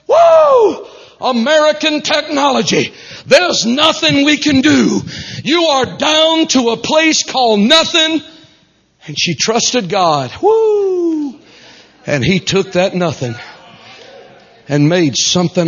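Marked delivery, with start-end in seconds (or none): 0.1-8.2 mock doctors. Doctors giving up, mocked. 10.4-11.4 high ringing woo shout; joyful woo holler. Real, not acted.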